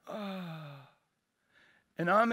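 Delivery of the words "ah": very soft, low "ah"; imitated gasp. Preacher showing his reaction to the cut-off prayer, soft imitated gasp. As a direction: let out a hushed, sunken sigh. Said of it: "I went".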